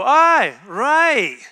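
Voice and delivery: loud, high, mocking "Aye, right"; scornful aye right. Shown as a demonstration. Preacher showing the scornful Aye, right to his church. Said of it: "But if I go".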